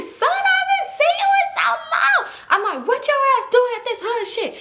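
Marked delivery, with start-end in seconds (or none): 0.0-2.3 very high-pitched squealing shout; overjoyed shriek of greeting, acted out; excited reunion. Play mom greets her long-unseen son, shrieking with excitement. 2.9-4.5 high-pitched incredulous demand; mock scolding. Play mom and son question each other, high mock outrage.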